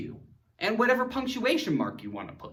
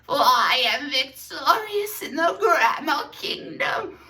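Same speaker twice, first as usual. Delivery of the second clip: hammed-up king; 7 semitones higher than usual. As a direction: declare victory like a melodrama king. Villain-king declaration example.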